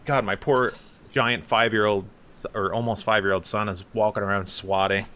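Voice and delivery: usual speaking voice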